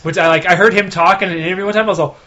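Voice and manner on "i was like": usual speaking voice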